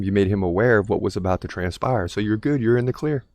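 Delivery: normal talking voice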